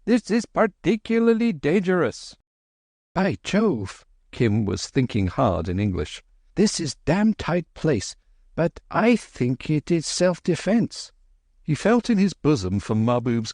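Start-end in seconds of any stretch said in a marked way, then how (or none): 0.0-2.4 high, anxious calling; a hint of panic in a high voice; high fretting Babu; fussy Babu. 3.1-11.1 put-on higher, boyish and tense; lightly voiced tense boy; Kim. Kim thinking in English.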